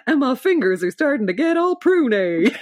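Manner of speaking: hammed-up imitation, hitting the end of "pruny"; cowboy lament. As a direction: overplay the cowboy's complaint, nearly laughing. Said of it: "he kinda looks at his hands and goes".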